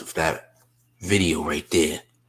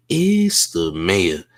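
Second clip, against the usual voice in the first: slow catchphrase; much slower than usual. Acted. Host cueing the footage with a slow, drawn-out catchphrase. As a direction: lean into each word slowly as a sign-off tag. Host's catchphrase before the clip.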